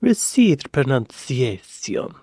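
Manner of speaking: slow, plummy received pronunciation; RP accent. Shown as a demonstration. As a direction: stretch it out like the BBC. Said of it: "R P".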